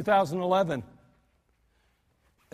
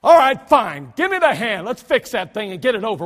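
loud exasperated burst that settles into a grudging sigh; grudgingly fix it to be done with it; annoyed, grudging Jesus; 8 dB louder than usual. Acted. Indignant Jesus healing the man with a loud grudging huff. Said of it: "Jesus, he says".

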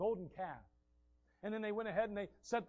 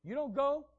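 higher, louder plea; urgent protest; 7 semitones higher, 7 dB louder than usual. Acted. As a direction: push back at God. Moses protests to God.

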